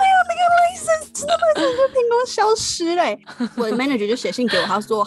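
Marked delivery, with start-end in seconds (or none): 0.1-3.1 much higher, wailing lament; exasperated wail. Real, not acted. Telling the host the licenses simply vanished, exasperated wail. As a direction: cry out in disbelief at the loss.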